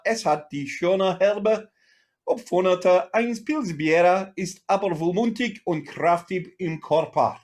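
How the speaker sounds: hammed-up German accent, slow and showy; mock German accent. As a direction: read it like a proud German brewer, in a German accent. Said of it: "in my finest, uh, German accent".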